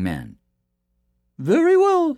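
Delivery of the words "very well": very high, louder mother voice; high storybook woman, giving in; boy's mother. Acted. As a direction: consent in a high-pitched character voice. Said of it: "said the boy's mother".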